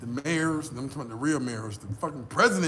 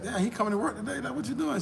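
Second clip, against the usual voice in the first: imitating the girl; 9 semitones higher than usual.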